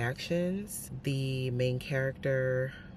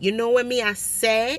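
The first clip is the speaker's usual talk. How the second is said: Jamaican patois with a posh lilt, higher and louder; mock uppity patois; 8 semitones higher, 10 dB louder than usual. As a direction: send up a stuck-up Jamaican patois speaker. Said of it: "trying to sound, like, uppity about it".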